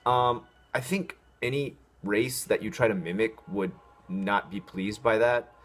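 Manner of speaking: normal talking voice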